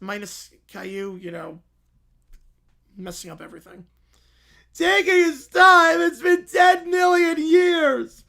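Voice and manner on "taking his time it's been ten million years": loud, slow, drawn-out shout; bored kid yelling at the golfer; impatient shout. Acted. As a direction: bellow it like an impatient child.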